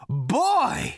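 very high, loud shout. Acted. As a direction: shout with glee. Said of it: "he shouted".

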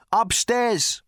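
high, projected one-word answer; booming thunder god. A narrator's reading. Thor answers Arthur.

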